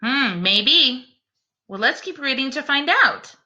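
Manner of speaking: usual speaking voice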